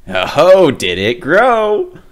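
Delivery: high, loud and slow amazement; big wonder; amazed. A narrator's reading. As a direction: marvel at the growing nose. Nose grows huge.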